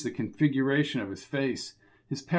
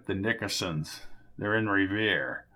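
put-on grudging old man; 6 semitones lower, 5 dB quieter than usual. Jerry gives in under threat.

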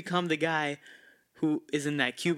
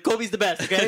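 louder, higher fed-up surrender; mock caving in, louder; exasperated giving in; 6 semitones higher, 7 dB louder than usual. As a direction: blurt it out just to end it. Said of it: "I was like".

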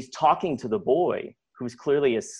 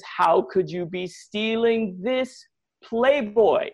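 high, slow, drawn-out accusation; mock outraged grandmother; 9 semitones higher, 4 dB louder, much slower than usual. Grandmother holds magazine up to the boy, outraged. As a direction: scold in disbelief, stretching every word higher.

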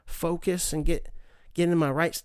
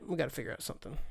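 much softer, trailing echo of We gotta figure out something; soft repeat; 4 semitones lower, 11 dB quieter, much faster than usual. Real, not acted. Echoing his own point to himself, much softer. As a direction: murmur it to yourself.

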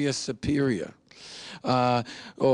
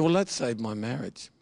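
ordinary delivery

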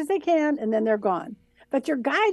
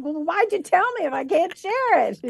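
higher-pitched complaining protest; mock exasperation; 5 semitones higher than usual. Medium arguing with guides about sharing, mock exasperated. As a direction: push back, comically put out.

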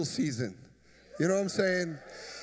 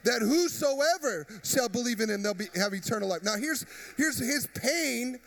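full force preaching; 5 semitones higher than usual. Acted. Pastor urges the congregation to be the fridge, preaching full force. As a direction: preach it out to the back pew.

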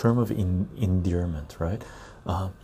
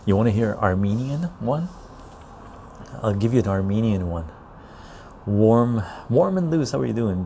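normal talking voice

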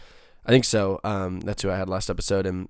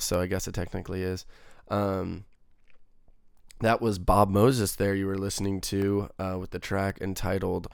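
usual speaking voice

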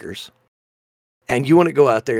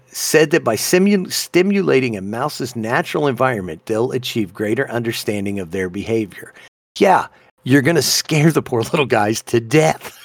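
ordinary delivery

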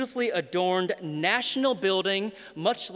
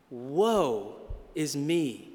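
slow lament; 4 semitones lower, much slower than usual. Acted. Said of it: "And he cries".

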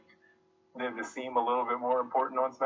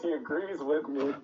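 ordinary delivery

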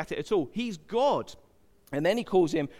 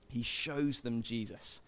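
soft slow emphasis; 6 semitones lower, 9 dB quieter than usual. Shown as a demonstration. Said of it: "does one thing".

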